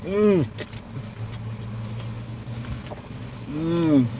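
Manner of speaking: humming while chewing food; chewing with mouth full. Real, not acted. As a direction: mm through closed full mouth. Seen 0: Jaw working, cheeks full after bite; burrito held beside him.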